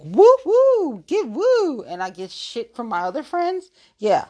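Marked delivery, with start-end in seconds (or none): 0.0-1.8 high, loud cheerleader whoops; cheerleader cheer. Retold or imitated. Cheering on her friends, loud high cheerleader whoops. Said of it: "I'm, like, a cheerleader and I'm like".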